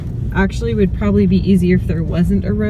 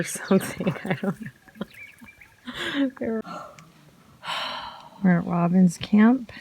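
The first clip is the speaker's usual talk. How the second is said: ordinary talk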